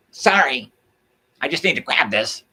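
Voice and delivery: high, loud fake apology; mock shopper. Shopper cutting in, mocked, loud fake sorry. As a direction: toss off a hollow sorry while grabbing.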